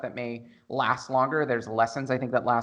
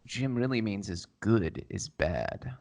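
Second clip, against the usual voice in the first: ASMR voice; 3 semitones lower, slower than usual. Pretend. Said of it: "try your A S M R voice on the microphone".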